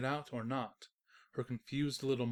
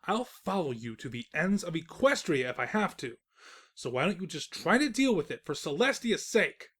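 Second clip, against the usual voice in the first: louder, firm lecture; louder stern warning; stern scolding; 4 semitones higher, 6 dB louder than usual. Acted. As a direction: lay down the law. Narrator scolding Twilight.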